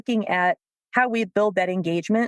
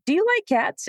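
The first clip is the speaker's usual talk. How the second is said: much higher, sing-song hint; playful code to back off; sly coded question; 10 semitones higher than usual. Retold or imitated. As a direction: slip in the question with a wink. Teasing coded question to trainer.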